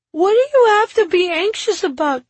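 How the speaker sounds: put-on high, loud kid's question; high-pitched little-kid voice; child's challenge. A narrator's reading. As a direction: demand an answer as an indignant child.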